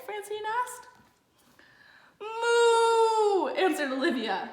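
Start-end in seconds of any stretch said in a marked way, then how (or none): 2.2-3.5 loud, high moo cutting in; louder moo. A narrator's reading. Olivia interrupting Francine again with a louder moo. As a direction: bellow the moo over her line. Olivia's big moment on stage.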